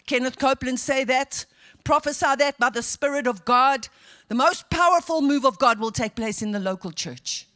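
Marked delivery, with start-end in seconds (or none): none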